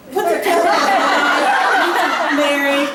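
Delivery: laughing while explaining. Retold or imitated. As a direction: giggle out the punchline about the fish hat. Retells joke about hat tail.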